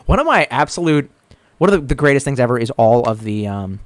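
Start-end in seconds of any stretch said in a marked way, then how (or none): none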